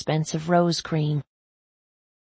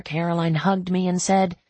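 usual speaking voice

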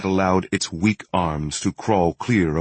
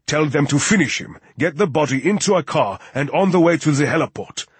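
higher-pitched, triumphant command; victorious orders; 6 semitones higher than usual. A narrator's reading. Riegel ordering the kill finished, victorious. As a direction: give orders as if it's already won. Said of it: "Riegel proclaimed victoriously".

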